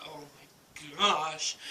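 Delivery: slow, drawn-out stunned awe; hushed, exaggerated disbelief, dragged out; mock freak-out. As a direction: imitate being floored, stretching each word. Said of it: "like, freaking out".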